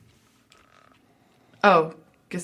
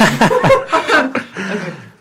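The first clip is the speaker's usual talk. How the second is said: hyena laugh; 8 dB louder than usual. Acted. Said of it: "so they can hear it".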